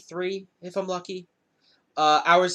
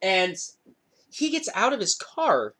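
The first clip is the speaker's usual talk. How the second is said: ordinary talk, nothing marked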